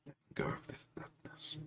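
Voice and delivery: breathy whispered release, very soft. Acted. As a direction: let it go in a hushed whisper.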